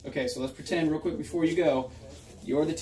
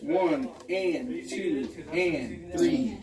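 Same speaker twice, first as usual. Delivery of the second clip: slow counting; much slower than usual. Shown as a demonstration. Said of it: "teacher demonstrating slow count".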